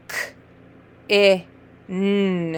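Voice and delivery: K, I, n with gaps, n held; separate letter sounds. Shown as a demonstration. Teacher modelling sounds for a reader, said separately. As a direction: sound out K, I, n one by one. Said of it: "Say the sounds".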